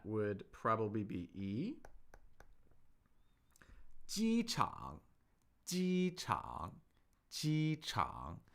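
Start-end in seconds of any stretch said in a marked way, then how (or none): 4.0-8.4 机场 with 机 high and level, 场 dipping then rising; first then third tone. Shown as a demonstration. Teacher modelling 机场 for the class, high level then dip. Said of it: "That's first tone, third tone".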